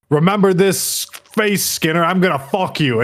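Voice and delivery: high, pushed vengeful threat; hammed-up defiance into the camera; mock threat. Host as Axel threatening Skinner on camera, mock threat.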